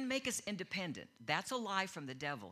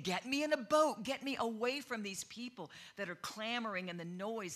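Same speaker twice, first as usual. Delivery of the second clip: higher, louder, urgent plea; exasperated call; 6 semitones higher, 5 dB louder than usual. Retold or imitated. Jesus crying out to disciples to escape the crowd, loud and high. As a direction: call it out, fed up with the noise. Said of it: "He said".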